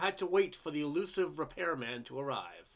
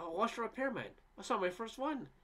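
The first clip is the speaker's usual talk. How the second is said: excited; 7 semitones higher than usual. A narrator's reading. Housewife excitedly reports rare sighting.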